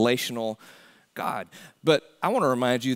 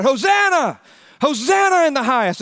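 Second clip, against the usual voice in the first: crowd cry of Hosanna, high and loud; shouted hosanna; 10 semitones higher, 6 dB louder than usual. Retold or imitated. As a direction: shout it like the crowd greeting the Messiah. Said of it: "and they shouted".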